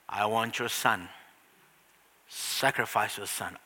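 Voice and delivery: deep hushed divine demand; solemn voice of God. Acted. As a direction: speak it low and heavy as God. Preacher voicing God's demand.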